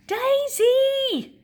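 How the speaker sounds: high loud call across the yard. Acted. Stanley calling his dog home, loud high call. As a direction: shout Daisy from far off. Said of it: "called Stanley".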